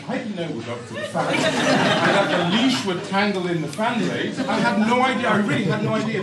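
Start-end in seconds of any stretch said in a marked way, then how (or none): 1.3-6.2 laughing. Real, not acted. Laughing insistence of innocence.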